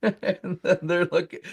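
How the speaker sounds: normal talking voice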